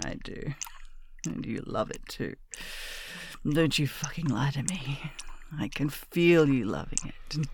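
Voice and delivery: hushed, close-mic ASMR whisper; intimate whisper roleplay. Acted. Miss murmuring to her pet, close whisper. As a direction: breathe every line close to the ear.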